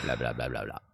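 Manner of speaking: very low voice. Pretend. Echoing the filler, very low.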